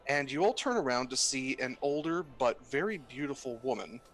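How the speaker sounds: ordinary talk, nothing marked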